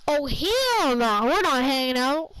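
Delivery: mock a rude girl, loudly stretching the words; rude refusal. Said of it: "rude about it".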